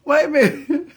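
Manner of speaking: high and loud through laughter; losing it laughing; cracking up mid-story. Real, not acted. Cracking up at the memory.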